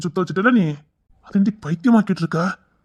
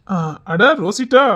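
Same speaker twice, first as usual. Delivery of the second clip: delighted greeting; 3 semitones higher, 7 dB louder, slower than usual. Acted.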